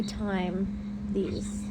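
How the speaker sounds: slow, hushed whisper; a slow whisper from inside her head; whispered inner voice. Retold or imitated.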